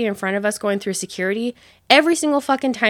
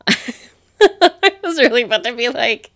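louder, laughing through the words; laughing at herself; 4 semitones higher, 5 dB louder than usual. Real, not acted. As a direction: crack up at your own blunder. Laughs at her own slip.

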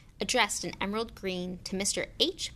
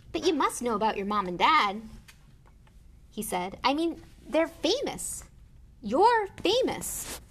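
astonished insistence; 6 semitones higher, 5 dB louder than usual. A narrator's reading. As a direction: insist it, amazed and louder. Hagrid astonished at Harry's ignorance.

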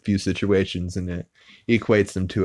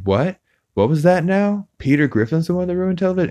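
much higher, fast disbelieving what; incredulous bystander; 11 semitones higher, faster than usual. Retold or imitated. Townsfolk hear Peter ruined TV, shrilly incredulous. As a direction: gasp out the question in disbelief.

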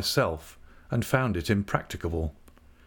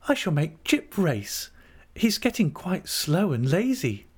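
much higher character voice; high-pitched, hopeful invalid wife; young wife Dora; 8 semitones higher than usual. A narrator's reading. Dora to her aunt about running about again, hopeful.

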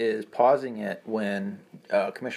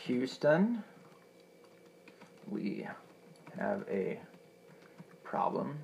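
whispering; 8 dB quieter, much slower than usual. Real, not acted. Reading out search slowly.

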